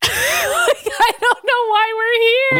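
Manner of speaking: words squeezed out through a high laugh. Real, not acted. Podcaster cracking up at a pointless scene, laughing while talking. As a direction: giggle through the line.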